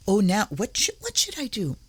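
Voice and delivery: high, dithering imaginary thinker; mock deliberation. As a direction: play someone puzzling over the obvious. Mimics someone pondering obvious habits.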